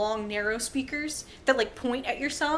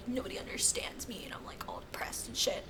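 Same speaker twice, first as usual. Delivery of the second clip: hushed, sulky mock whisper; much softer, moody mock grumble; cranky self-pity; 14 dB quieter than usual. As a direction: imitate a brooding author muttering under the breath. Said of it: "he's all, like, cranky".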